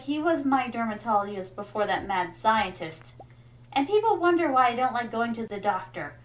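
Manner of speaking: ordinary talk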